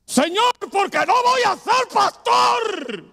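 drawn-out, high-pitched yell at God; furious shouting. Acted. Upset man gripping the wheel, questioning the Lord, shouting. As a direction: scream the question out slowly at full height. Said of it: "y gritando".

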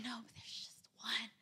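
near-whispered retold admission; hushed confession. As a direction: drop to a soft, breath-only murmur, imitating the boy. Boy hesitantly admitting a sin.

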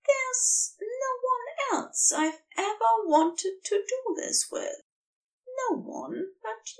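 a steady vow from the angel, dropping to a hush; Aziraphale, firmly. Acted. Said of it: "Aziraphale says firmly".

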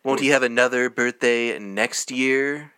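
slow, drawn-out put-on tone; Comic Book Guy. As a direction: stretch out the question as a mock know-it-all character. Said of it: "You sound a sort of like comic book guy".

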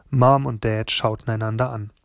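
ordinary delivery, nothing marked

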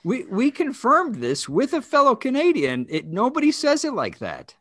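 high-pitched, exasperated objection; exasperated protest. Real, not acted. Mock quarrel over bad accent.

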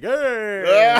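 put-on loud, high drunken holler; mock drunk greeting, laughing; drunk friend imitation. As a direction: yell it out like a happy drunk. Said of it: "And Sammy's like".

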